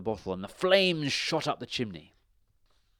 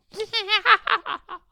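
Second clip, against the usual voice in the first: very high, loud cackling laugh; shrieking high witch cackle; witch's cackle; 9 dB louder than usual. A narrator's reading. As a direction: let out a piercing cackle.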